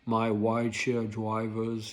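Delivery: slow, put-on guru voice; a hint of the rideshare guru; Rideshare Professor impression. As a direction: lightly mimic the YouTuber's greeting. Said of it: "I gotta watch out with that voice".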